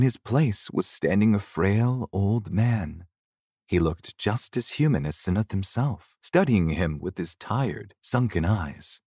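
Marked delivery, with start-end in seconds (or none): none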